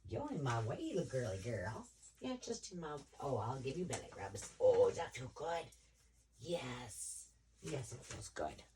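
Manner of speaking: cooing to the cat. Real, not acted. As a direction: coo at the cat while rubbing her belly. Talking to the cat.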